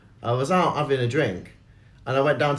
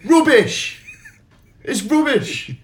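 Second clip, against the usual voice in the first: mock heckler dismissal; 12 semitones higher, 5 dB louder, much slower than usual. Heckler dismissing every point.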